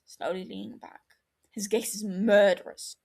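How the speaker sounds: usual speaking voice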